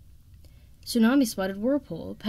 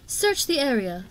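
higher-pitched command; barking orders; 6 semitones higher than usual. A narrator's reading. Queen Coral orders a search, barking. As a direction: bark it out as an order. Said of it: "Queen Coral's voice barking orders".

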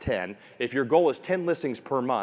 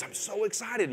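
excited; 9 semitones higher, 5 dB quieter than usual. Retold or imitated. Agent calls thrilled about listings.

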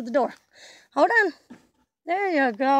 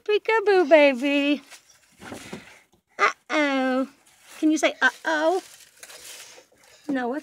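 ordinary delivery

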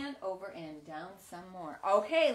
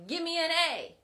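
cheer; 7 semitones higher, 5 dB louder, faster than usual. Shown as a demonstration. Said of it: "we're gonna cheer this word out".

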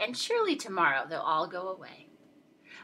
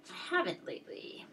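whispered aside, almost to herself; 2 semitones higher, 8 dB quieter than usual. Real, not acted. Teacher confesses to the class in a whisper. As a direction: drop to a sheepish whisper.

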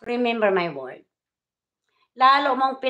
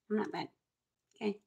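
quiet; 15 dB quieter, much faster than usual. Real, not acted. Repeated denial, softer.

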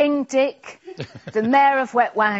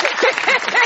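laughing; 14 semitones higher, 4 dB louder than usual. Real, not acted. Host cracks up.

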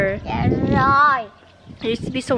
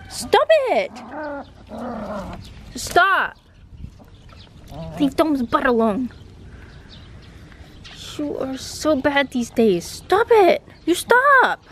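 angry yelling; 4 semitones higher than usual. Real, not acted. Owner shouting at the dog to leave the puppies alone. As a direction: drive the dog away from the puppies.

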